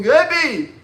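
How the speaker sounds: high, loud anguished pleading; screaming students. Retold or imitated. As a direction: shout it up at your dying teacher. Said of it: "They were screaming".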